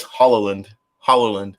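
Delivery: Holland stretched to three syllables, HOLL-uh-lund; suggested pronunciation. Shown as a demonstration. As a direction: say Holland as HAH-luh-lund. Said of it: "Octopus says Holland".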